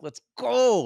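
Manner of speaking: fired-up mock cheer in a cinema; hyped shout. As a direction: cheer it like a crowd in a theater. Hyped theater crowd reaction.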